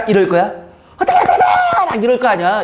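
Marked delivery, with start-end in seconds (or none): 1.0-1.4 very high, giddy squeal of a student in a fluster; mock fussing. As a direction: squeal it like an overexcited exam passer. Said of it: "이거 호들갑 떠는 거 맞아". (호들갑스럽게 흉내 내는 높은 비명)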